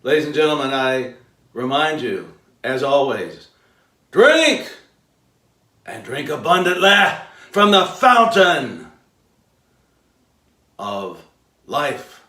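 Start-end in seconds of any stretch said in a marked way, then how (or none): 4.1-12.2 sermon sign-off. Acted. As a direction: proclaim it like a preacher's blessing. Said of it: "signature sign-off".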